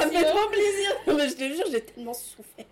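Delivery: high, laughing, half-shouted delight; laughing with joy. Real, not acted. Told her Wolof is great, she laughs out her joy. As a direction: gush through laughter, relieved. Overjoyed after Wolof compliment.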